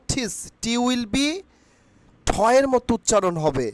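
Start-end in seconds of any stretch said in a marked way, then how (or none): 0.1-0.3 ঠিস with a puffed T, word set apart; aspirated T. Shown as a demonstration. Teacher drilling students on the word teach, aspirated T. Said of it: "টিচের উচ্চারণ কি করব".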